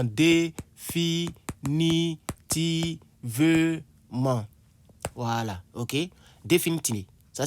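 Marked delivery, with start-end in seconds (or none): 0.0-4.4 Définitivement drawn out syllable by syllable; teacher spells out Définitivement slowly for students; slow dictation. Shown as a demonstration. Spell out each syllable of Définitivement. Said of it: "c'est définitivement".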